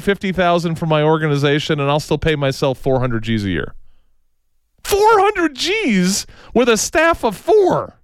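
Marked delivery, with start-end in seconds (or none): 4.8-7.9 mock disbelief. Mocking incredulous repeat.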